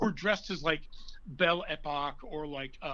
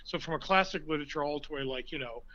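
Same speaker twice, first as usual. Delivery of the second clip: ordinary talk, nothing marked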